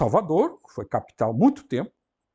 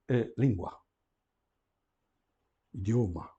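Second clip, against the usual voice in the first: normal talking voice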